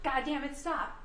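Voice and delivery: repeats the angry yell of the original, loud on the first "Stop!". Retold or imitated. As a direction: shout it out like the tape. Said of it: "in that tone of voice".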